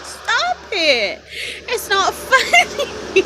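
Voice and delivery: high, drawn-out plea breaking into laughter; fail to stay stern, giggling through it; scolding while laughing. Retold or imitated. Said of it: "my daughter got me laughing".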